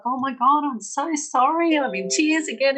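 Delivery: high, loud flustered apology through tears; apologizing to her trainer, half crying; tearful apology. Retold or imitated. Retold to host, a flustered tearful sorry. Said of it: "I'd just burst out crying".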